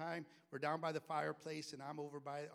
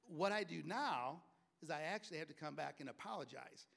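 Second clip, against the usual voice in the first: usual speaking voice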